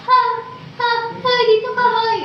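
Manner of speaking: high, half-hearted call to a distant friend; mock weak call. Shown as a demonstration. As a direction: imitate a shout that fails to carry. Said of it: "yung kaibigan mo sa malayo". (kunwaring tawag na kulang sa sigaw)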